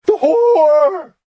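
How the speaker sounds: high, loud shriek; a sudden mad scream. Acted. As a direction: scream it out of nowhere. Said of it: "Godwin screamed again".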